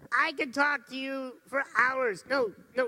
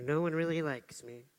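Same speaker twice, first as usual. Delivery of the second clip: glum self-talk; 6 semitones lower than usual. Pretend.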